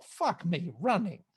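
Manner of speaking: normal talking voice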